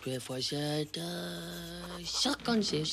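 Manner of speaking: chuchoté. Acted. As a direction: tout bas, en cachette. Calcule en cachette. (Titeuf calcule en douce, à voix très basse)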